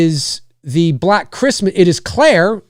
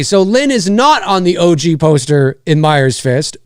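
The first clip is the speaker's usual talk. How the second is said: usual speaking voice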